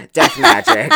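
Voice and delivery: high laughing burst. Real, not acted. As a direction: blurt it out through laughter. Laughing at her own idea.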